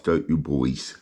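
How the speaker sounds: ordinary delivery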